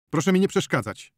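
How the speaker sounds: high, loud, irritated order; angry shout. A narrator's reading. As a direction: snap loudly at someone interfering. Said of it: "krzyknął doktor".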